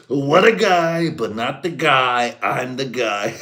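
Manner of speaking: high, very loud, slow brag; mock Trump. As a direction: puff up like the only guy. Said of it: "I can just hear".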